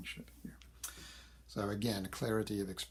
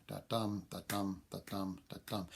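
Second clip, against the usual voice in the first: "da-dum" chanted in even beats, stress on "dum"; iambic rhythm. Shown as a demonstration. Lecturer taps out the line's metre, "da-dum" stressed on "dum". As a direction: mark the metre with "da-dum", weight on each "dum". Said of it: "that's the rhythm of this poem".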